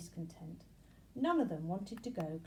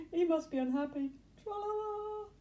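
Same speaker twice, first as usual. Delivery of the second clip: higher sing-song mocking voice; taunting grasshopper; 10 semitones higher, 6 dB louder than usual. A narrator's reading. Second grasshopper teasing Benji, sing-song and high.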